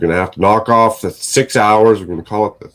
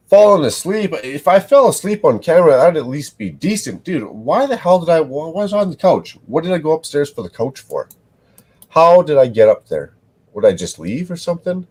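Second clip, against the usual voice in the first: exasperated rant; 9 semitones higher than usual. Real, not acted. Exasperated rant about last night.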